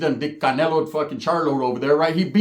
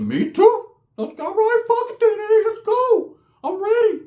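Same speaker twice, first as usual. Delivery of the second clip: high, loud cocky bravado; mock tough guy; 4 dB louder, slower than usual. Kid talking big before the fight, hammed-up high tough voice.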